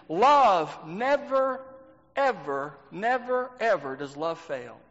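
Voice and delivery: NEVER and EVER drawn out, with pauses between each word; slow emphatic repetition. Real, not acted. Preacher driving home to the congregation that love never fails, slow and emphatic.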